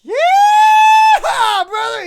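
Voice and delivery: hillbilly voice. Acted. Starfire answers the question about dating in Southern hillbilly holler. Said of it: "the hillbilly voice".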